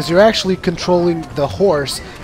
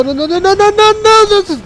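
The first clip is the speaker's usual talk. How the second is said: climbing higher and louder with each no; rising panic; 7 dB louder than usual. Retold or imitated. Gamer watching his chariot plunge into the river, panicked rising cries of no. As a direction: watch the chariot go over and panic, pitch shooting up.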